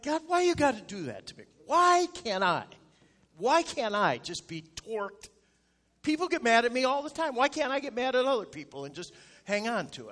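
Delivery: hammed-up whining protest, pleading why me; indignant complaint to God. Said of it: "I'm like".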